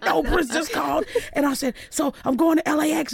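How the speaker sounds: high, thrilled insistence; excited insisting. Retold or imitated. Daughter insisting to her doubting mom, thrilled high voice.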